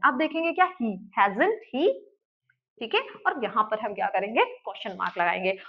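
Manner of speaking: normal talking voice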